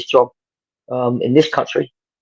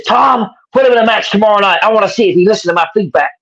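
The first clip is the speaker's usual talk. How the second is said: attempted American accent; 8 semitones higher than usual. Telling the coach to book the kid, American accent. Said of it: "I can't do an American accent, but I'll try".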